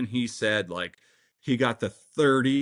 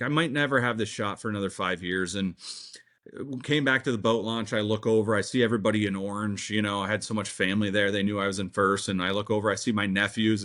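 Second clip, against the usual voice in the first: ordinary delivery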